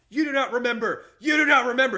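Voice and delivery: high, very loud shout as an angry God; acted screaming. God overruling the man in the story, acted screaming. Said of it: "He's screaming".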